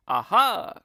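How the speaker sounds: loud, high triumphant aha; gleeful leader ready for the trick; triumphant cry. A narrator's reading. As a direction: crow it out with glee. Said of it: "cried Mugglewump".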